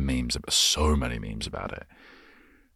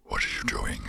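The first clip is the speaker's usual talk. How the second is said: lower, sinister turn dropping to a hushed whisper; menacing puppet; 4 semitones lower, 4 dB quieter than usual. Retold or imitated. A puppet turning suddenly sinister, lower and hushed. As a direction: go quiet and creepy on the last words. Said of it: "then it changes and he's like".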